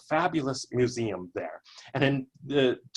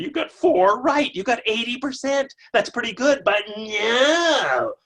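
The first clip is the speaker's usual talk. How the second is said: enthusiastic praise; 9 semitones higher, 5 dB louder than usual. Retold or imitated. Praising version, rising to a cry.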